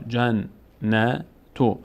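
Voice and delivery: جنتو drawn out, long aa held; slow recitation. Shown as a demonstration. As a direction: say جنتو slowly as modelled. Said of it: "فتحه بعدش الف صدای آ".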